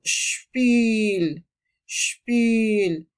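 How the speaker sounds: Spiel said shpeel, with a long ee; German pronunciation. Shown as a demonstration. Teacher modelling Spiel with a long vowel. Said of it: "se pronuncia como una I larga".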